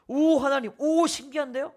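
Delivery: high, wide-eyed wonder; preacher voicing an astonished Moses; amazed Moses. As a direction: marvel at the miracle like a startled Moses.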